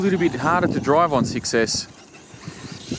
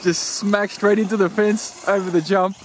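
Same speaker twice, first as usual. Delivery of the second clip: laughing; 4 semitones higher, slower than usual. Real, not acted. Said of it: "I'm laughing at my own silliness".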